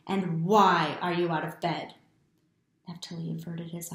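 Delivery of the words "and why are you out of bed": stern father. A narrator's reading. Angry father scolding son.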